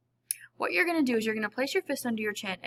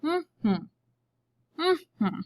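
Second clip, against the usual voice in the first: higher hummed beats, lips closed; humming the syllables; 7 semitones higher than usual. Shown as a demonstration. Teacher demonstrating to the class, humming two beats. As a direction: hum each syllable high. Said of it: "Let's hum it out".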